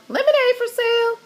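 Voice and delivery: high, fast squawking parrot voice. A narrator's reading. Parrot Petey squawking to passersby, high and shrill. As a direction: squawk it out like a parrot hawking lemonade. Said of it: "Petey squawked".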